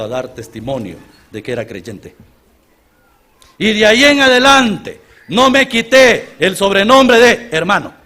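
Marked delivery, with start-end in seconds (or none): 3.6-7.9 emphatic preaching. Real, not acted.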